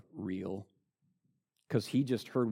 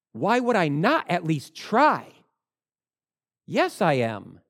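bold retort; 6 semitones higher, 5 dB louder than usual. Acted. Believer answering a skeptic boldly.

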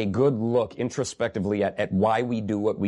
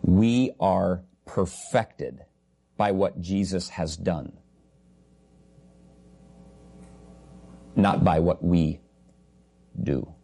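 lower, weighty, each phrase set apart with pauses; grave and slow; 4 semitones lower, slower than usual. Real, not acted. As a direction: pastor repeats it slowly so the congregation feels its gravity, grave and measured. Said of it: "please pay attention this time and understand the gravity".

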